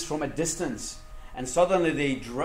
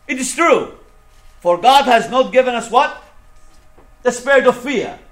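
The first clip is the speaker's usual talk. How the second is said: high, forceful call-and-answer sermon voice; emphatic preaching; 8 semitones higher, 11 dB louder than usual. Acted. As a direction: drive the verse home to the congregation. Drives verse home to congregation.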